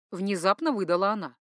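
ordinary delivery

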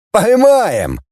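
high, exuberant call; jolly. A narrator's reading. Volunteers below promise to catch the scientist, jolly shout. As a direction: shout up cheerful reassurance. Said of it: "хохотали внизу".

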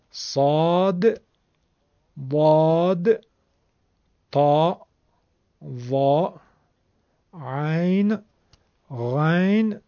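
"صاد، ضاد، طا، ظا" heavy and emphatic, "عین، غین" from the throat; Arabic letter names. Shown as a demonstration. Teacher lists special Quranic letters in careful Arabic articulation. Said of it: "تلفظشون رو ویژه است".

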